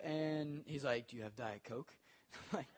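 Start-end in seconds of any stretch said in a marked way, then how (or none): 1.1-1.9 much lower, very soft mumbled ask; a quiet, shy kid asking for a Diet Coke; kid's small voice. Retold or imitated. Kid's request voiced in story.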